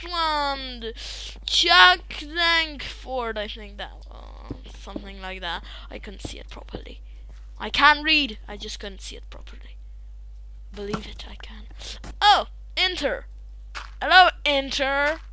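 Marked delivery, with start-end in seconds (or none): 5.9-11.6 unvoiced, fast whisper. Real, not acted. Muttering an aside to the viewers in a whisper.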